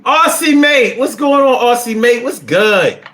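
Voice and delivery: high, loud shout-out, easing down by the end; excited greeting. Real, not acted. Streamer hails an Aussie viewer, excited greeting. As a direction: greet a friend with a big high holler.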